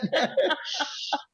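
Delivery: high breath of laughter; high, airy chuckle; amused laugh. Real, not acted. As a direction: laugh at your own punchline. Laughing at own story.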